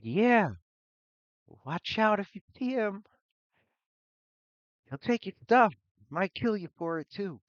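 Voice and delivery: put-on high, loud kid voice; kids shouting back. Kids warning Alor about the thief, shouting.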